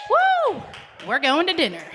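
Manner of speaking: high, loud woo, then upbeat announcement; excited cheer. Real, not acted. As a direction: whoop for the dinner prize. Celebrating a dinner prize winner.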